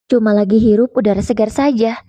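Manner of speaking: plain reading voice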